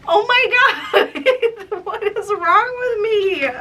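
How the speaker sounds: high, loud embarrassed laughing; cringe-laugh at yourself, real and loud; laughing in embarrassment. Real, not acted. Cringing at her old song.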